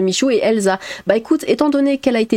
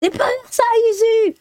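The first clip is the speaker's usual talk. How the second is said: much higher put-on scold, same loudness; her own scolding catchphrase. Streamer redoes her own catchphrase as an exasperated scold, much higher. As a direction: play the exasperated mum at the light switch. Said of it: "c'est un truc que je dis tout le temps".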